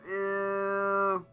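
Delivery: normal talking voice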